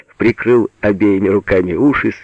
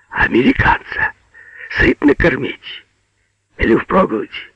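high, half-whispered questions; hushed questioning; 10 semitones higher than usual. A narrator's reading. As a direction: ask cautiously by his ear. Агеич quietly asks dazed Пётр. (осторожно, полушёпотом)

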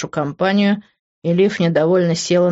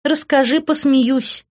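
high-pitched, mocking woman's reply; ironic; 9 semitones higher, slower than usual. A narrator's reading. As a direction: invite him to explain, with open sarcasm. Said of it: "иронически ответила она".

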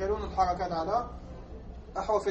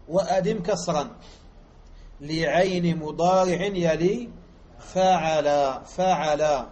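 louder, slow word-by-word reading with pauses, stretching "فعل" at the end; slow explanatory reading; 5 dB louder, slower than usual. Shown as a demonstration. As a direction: break the line apart slowly to teach it. Teacher re-reads the words slowly to explain. (قراءة بطيئة كلمة كلمة للشرح)

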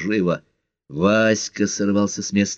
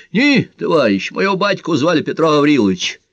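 loud, higher-pitched denial; raised, faintly agitated phone reply; slightly wavering voice; 8 semitones higher, 4 dB louder than usual. A narrator's reading. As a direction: correct the caller with a slight waver. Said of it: "Егор позволил голосу чуть дрогнуть".

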